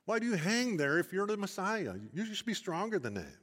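passer-by mocking Jesus on the cross, jeering. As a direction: taunt him like a jeering bystander. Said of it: "they're mocking Jesus".

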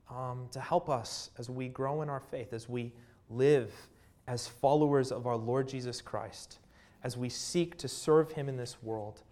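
soft leading prayer voice. Acted. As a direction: pray quietly over the congregation. Leads congregation in prayer.

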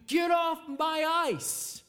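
put-on high, slow shout of a crotchety old man; angry neighbor yelling from his house. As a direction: bellow it at the kids on the ice. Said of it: "starts yelling at you".

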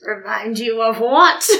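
slow creature impression; the Drode's voice. Shown as a demonstration. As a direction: stretch it out in an imitated creature voice. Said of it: "see if we can imitate it".

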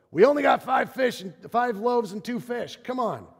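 complain, fed up with the task; exasperated disciples. Retold or imitated. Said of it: "they just go".

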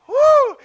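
high loud woo; one sharp gleeful cry; joyful whoop. Real, not acted. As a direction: burst out whooping. Preacher whoops after laughing.

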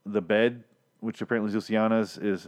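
usual speaking voice

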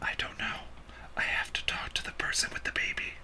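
soft unvoiced whisper. Real, not acted. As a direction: whisper it, half to yourself. Aside about the drive-ins.

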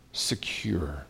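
drops to a breathy whisper on the last word. Acted. Pastor reading the psalm to his congregation, whispering.

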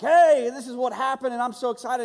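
high, loud eager boasting; excited. Retold or imitated. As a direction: burst in, thrilled, to share the news. Young self excited to grandma.

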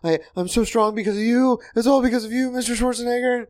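very high, adoring fanboy voice; gushing fan. Retold or imitated. Weightlifting fan thanks his idol, high starstruck voice. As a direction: thank your hero in a tiny high voice. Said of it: "I really wanted to be like".

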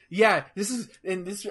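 usual speaking voice

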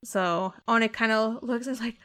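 normal talking voice

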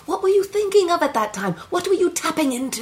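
put-on high-pitched, urgent British-accented questioning; probing acting coach. Coach probing the player's feelings, urgent. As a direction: press the actor for the memory.

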